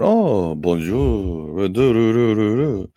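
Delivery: high, hammed-up French sing-song; mock a French accent in a sing-song. Mocking the vice president's fake French accent, hammed-up. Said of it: "singing like Pierre from Beauty and the Beast".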